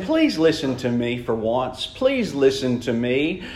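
low, soft begging father; soft pleading. Retold or imitated. Father to his son, soft pleading. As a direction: plead gently, almost under the breath. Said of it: "He's saying".

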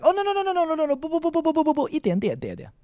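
high-pitched rapid-fire no-no-no, dropping at the end; polite refusal. Shown as a demonstration. Teacher acting out turning down offered water, high flustered refusal. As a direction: refuse in a fluttery stream of high syllables. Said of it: "Or be like".

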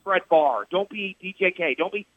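ordinary talk

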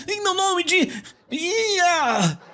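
high, strained parody of a showy prayer; mock showy prayer. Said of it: "Eu vejo as pessoas orando".

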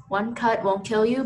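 ordinary delivery

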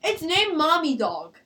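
slow, matter-of-fact answer; dead serious. Real, not acted. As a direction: state it in all seriousness, as a normal name. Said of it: "She said it with such seriousness".

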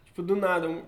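normal talking voice

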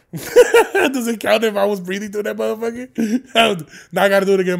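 loud, high, laugh-filled words; fail to hold it in and laugh through the words; bursting out laughing. Real, not acted. Host breaking his moment of silence, laughing.

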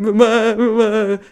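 put-on high, loud whiny child's call; playful imitation of a tearful kid; kid calling mum. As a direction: mimic a small boy crying for mum. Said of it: "esta cena de puto".

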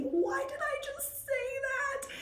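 very high, slow, softened squeal; anguished inner thought. Retold or imitated. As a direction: squeak it out in mock agony. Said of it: "inside of my heart thinking".

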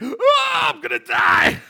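high pained cry into a shout; mock dying groan. To wife on the steep hill, mock dying wail. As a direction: ham up the agony of the climb. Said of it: "you're like".

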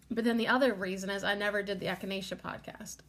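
normal talking voice

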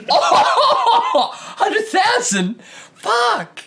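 high, slow laughing shock; laughing in disbelief. Real, not acted. Shocked at Indy crowd figure.

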